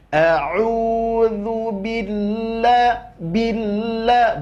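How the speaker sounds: slow a'udzu billah, the lam pressed; recitation with nabroh. Shown as a demonstration. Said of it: "itu namanya nabroh atau tekanan". (bacaan billah dengan nabroh)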